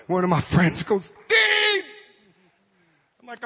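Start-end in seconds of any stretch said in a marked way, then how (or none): 1.3-1.9 high imitated call. Friend calling him by name at camp, high imitated cry.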